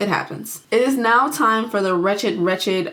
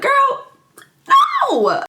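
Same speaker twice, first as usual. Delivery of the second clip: much higher, louder squeal of refusal; dismissive yelp; 6 dB louder than usual. Retold or imitated. Jack shutting down his pleading wife, high loud squeal. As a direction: shriek it back like a flat no.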